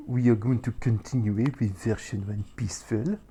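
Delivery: English put through a hammed-up French accent; jokey French accent on English words; mock French accent. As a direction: play the Frenchman for a joke. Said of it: "we keep this accent up".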